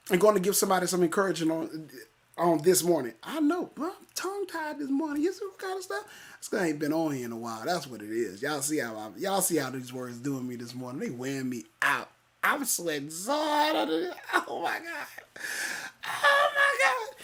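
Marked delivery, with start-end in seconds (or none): 3.2-6.0 high, quiet little voice; soft, high-pitched mock whine; mock complaining. Mock complaint about himself in another voice. 6.4-12.1 low, quiet commentary on himself; hushed, low-pitched remark; playful low aside. Pretend. 12.4-17.2 hammed-up high, soft wail; playful, high-pitched whimper; mock distress.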